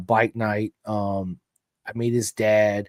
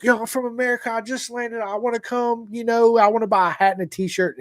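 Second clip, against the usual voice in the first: much higher, eager and rushed; hyped-up American caller; 11 semitones higher than usual. Retold or imitated. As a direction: burst with excitement on the phone. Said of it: "your energy is just so intense".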